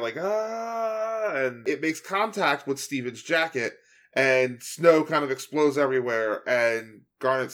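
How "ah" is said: mock yell. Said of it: "starts yelling out".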